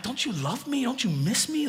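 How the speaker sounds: playfully wounded, asking for sympathy; mock hurt plea. Pastor teasing his wife on the phone, mock hurt. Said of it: "I'm like".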